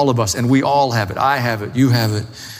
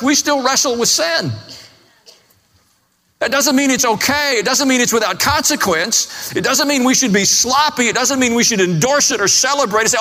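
raised, fervent preacher's voice, higher; preaching at full force; 11 semitones higher than usual. Acted. Pastor urging his church about sin, fervent and raised. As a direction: drive each point home to the pews.

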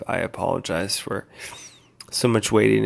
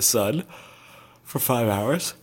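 talking through a yawn; words stretched by a yawn; slower than usual. Real, not acted. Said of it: "I'm yawning".

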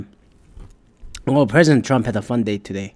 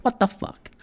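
mock baffled; 11 semitones higher, 4 dB quieter than usual. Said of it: "I just go".

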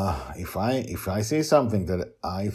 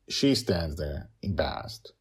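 ordinary delivery

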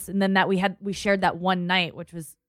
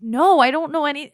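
high, louder frantic answer; panicked denial; 9 semitones higher, 7 dB louder than usual. Retold or imitated. Teen answering cops after the death, high and panicked. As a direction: blurt it out in panic. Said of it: "And I was just like".

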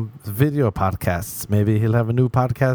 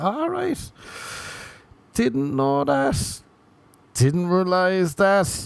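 bemused; 8 semitones higher, much slower than usual. Retold or imitated. Blacked out, bemused reply.

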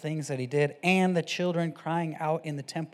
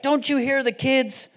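higher, louder mock complaint; mock indignant; 5 semitones higher, 5 dB louder than usual. Preacher voices mad priests confronting Jesus, mock indignant. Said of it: "They're all mad".

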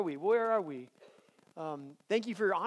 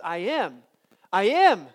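loud, high rising I am declarations; preacher voicing Jesus's I am, building; preaching at full force; 5 semitones higher, 9 dB louder than usual. Real, not acted. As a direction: build the proclamation to a peak.

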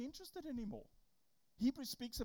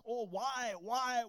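lightly hammed-up pleading mom; mock praying mom; 2 semitones higher, 5 dB louder, slower than usual. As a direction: tease a mom praying dramatically. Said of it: "carrying on".

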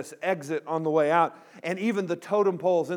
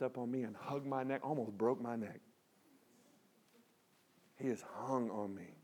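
soft, low-pitched storytelling with long pauses; hushed, tender preaching; 6 semitones lower, 13 dB quieter than usual. Acted. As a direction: let it land quietly and sincerely. Tender climax and hushed application.

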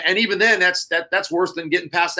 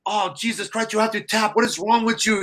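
mild mock yell, pitched up without volume; retold screaming; 7 semitones higher than usual. Coach scolds the choked-out wrestler, mild mock screaming. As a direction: imitate his freak-out at a higher pitch, holding back the volume. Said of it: "he's, like, screaming at me".

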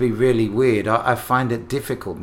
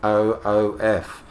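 slow, letter by letter; spelling it out; 3 dB louder, slower than usual. Shown as a demonstration. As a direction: spell each letter out slowly. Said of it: "We spell woof".

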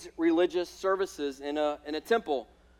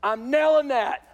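cocky boast; 5 semitones higher than usual. Retold or imitated. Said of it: "so he can go".

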